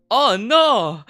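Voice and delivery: high, loud cartoonish yelp of dismay; Joseph Joestar "Oh no!". Streamer imitating Sonic's random English, Joestar-style outburst. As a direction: shriek it like an over-the-top anime hero. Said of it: "it's very Joseph Joestar".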